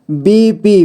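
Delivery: बीपी drawn out as बी-पी; syllable by syllable. Shown as a demonstration. Teacher showing beginners how to read बीपी, syllable by syllable. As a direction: give each half of बीपी its own beat. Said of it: "जोड़ जोड़ करके ही पढ़ना होगा".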